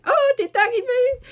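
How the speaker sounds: high, loud burst of joy; gleeful high-pitched hello; excited greeting. Retold or imitated. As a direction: squeal it like a delighted friend. Friend greets her on phone.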